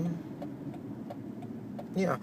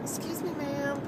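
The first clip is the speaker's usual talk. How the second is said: higher, softer lady's voice, politely asking; little soft voice; 8 semitones higher, 9 dB quieter than usual. Retold or imitated. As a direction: imitate a timid stranger's soft, polite voice. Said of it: "She had a little soft voice".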